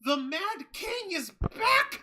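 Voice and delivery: high, loud, drawn-out disbelief; shocked outburst. Real, not acted. Co-host reacting to news of a wrestler's return, shocked outburst. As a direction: blurt it out in loud, stretched amazement.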